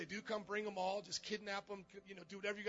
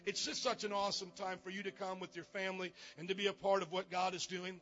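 normal talking voice

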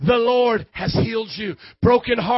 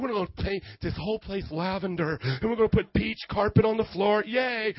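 soft, lower-key effeminate decorator; lightly mock a fussy TV home decorator; effeminate remodelers; 3 semitones lower, 7 dB quieter than usual. Preacher imitates home remodelers on the show, mock effeminate. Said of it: "little effeminate guys".

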